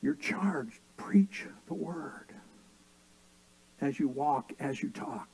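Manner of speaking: a hushed, breathy charge to the congregation; whisper. Acted. Preacher charging his flock, whispered.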